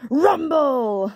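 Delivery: loud, rising rumble sound effect; rumbling noise. A narrator's reading. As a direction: boom out the storybook noise. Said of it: "went the noise".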